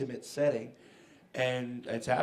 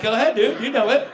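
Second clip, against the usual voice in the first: much higher, louder, laughing taunt; mocking taunt; 10 semitones higher, 11 dB louder than usual. Front-row fans mocking the singer who forgot his lines, laughing. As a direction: tease a stuck actor through the laugh. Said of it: "they're like".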